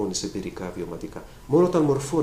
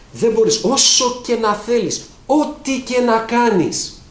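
preaching at full force; 10 semitones higher, 7 dB louder, much slower than usual. Acted. Preacher stressing the inevitable.

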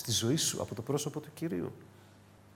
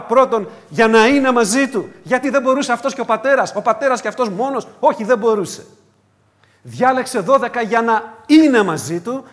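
raised, louder preaching voice; preaching at full force; 7 semitones higher, 10 dB louder than usual. Acted. Pastor preaching to the congregation, raised and louder. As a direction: drive the point home from the pulpit.

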